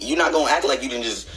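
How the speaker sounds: ordinary talk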